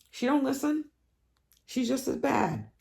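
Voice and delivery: high mocking exasperation; exasperated. Real, not acted. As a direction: squeak it up in disapproval.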